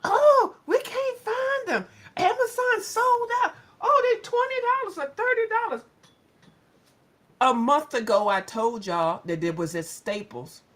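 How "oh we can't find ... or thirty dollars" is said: mocking whiny shoppers. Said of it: "y'all be like".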